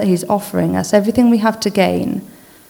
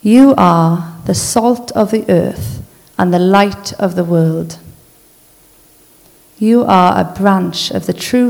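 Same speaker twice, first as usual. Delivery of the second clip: slow, measured reading with long pauses between lines; slow scripture reading. Acted. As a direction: read each truth slowly so it sinks in. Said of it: "I'll just read these out slowly".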